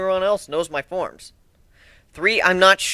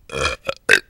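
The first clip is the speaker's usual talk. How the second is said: cough. Real, not acted. Narrator clears throat.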